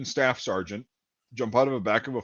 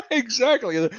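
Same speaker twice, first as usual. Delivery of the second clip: high, laughing agreement; 12 semitones higher, slower than usual. Real, not acted. Laughing along with partner's joke.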